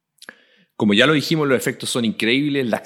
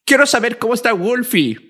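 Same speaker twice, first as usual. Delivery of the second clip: put-on child voice; 8 semitones higher than usual. Terminator voicing the boy.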